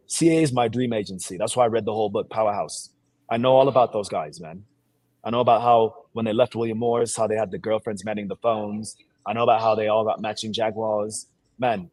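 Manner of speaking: normal talking voice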